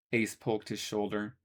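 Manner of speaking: plain reading voice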